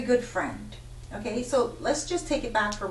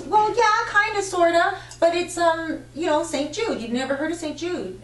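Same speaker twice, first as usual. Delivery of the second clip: imitating client; 7 semitones higher, 5 dB louder than usual. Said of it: "she goes".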